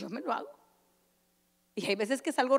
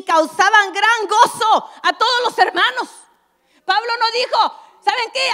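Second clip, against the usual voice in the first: much higher, louder preaching climax; fervent preacher driving the point home, higher and louder; full-force preaching; 8 semitones higher, 10 dB louder than usual. Acted. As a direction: preach it out big and rising.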